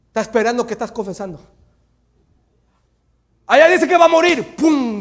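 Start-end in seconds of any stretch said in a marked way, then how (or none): 3.5-5.0 high, shouted announcement; loud, dramatic preacher's outburst; forceful preaching. Acted. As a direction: cry it out from the pulpit.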